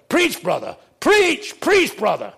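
loud, high deacons' holler; yelling. Retold or imitated. Deacons in the front row to preacher, yelling. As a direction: urge the preacher on at full voice. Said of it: "the deacons yell".

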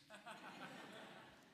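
a quiet breathy laugh at his own story; soft laugh. Real, not acted. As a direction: let a short soft laugh slip out. Laughs after anecdote.

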